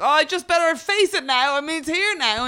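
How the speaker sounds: retelling own hungover excuse, high and loud; mock resigned self. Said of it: "and I was like".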